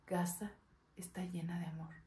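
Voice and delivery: soft, fading almost to a whisper; tender, hushed. Acted. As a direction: let the closing words fade away tenderly. Tender close of child's monologue.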